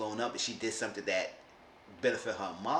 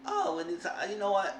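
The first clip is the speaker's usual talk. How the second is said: imitating mom softening; 11 semitones higher than usual. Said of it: "and the mom said".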